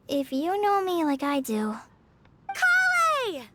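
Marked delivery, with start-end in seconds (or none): 2.5-3.4 bright call from a distance across town. Acted. Amber calling Collei from across the street, loud. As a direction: call her name from far off.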